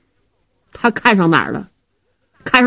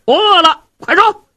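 impatient; 8 semitones higher, 4 dB louder than usual. Acted. Hungry Zhao Hu pressing servant.

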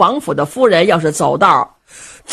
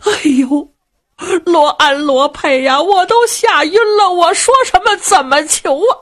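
put-on high, breathless frightened old mistress; a flustered noblewoman near tears; panicked old lady; 9 semitones higher than usual. Old mother asks servants how to plead, panicked high voice.

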